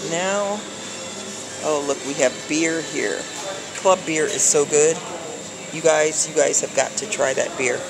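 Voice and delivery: ordinary talk, nothing marked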